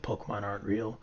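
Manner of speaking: put-on a tired adult shutting down a kid's fantasy, quietly; flat grown-up voice. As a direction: sound deflated, like a grown-up killing the dream. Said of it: "it's like".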